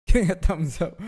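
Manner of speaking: laughing out the words, high and loud. Real, not acted. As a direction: crack up while saying it. Laughs at own joke.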